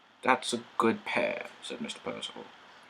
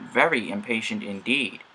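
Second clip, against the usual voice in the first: ordinary delivery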